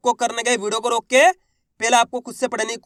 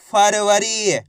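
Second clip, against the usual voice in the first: usual speaking voice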